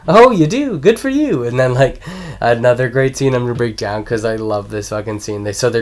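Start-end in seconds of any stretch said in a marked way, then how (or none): 0.1-1.4 high, swooping mock admiration; hammed-up impressed voice; exaggerated praise. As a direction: gush as if to a small child. Acted mock praise to movie kid.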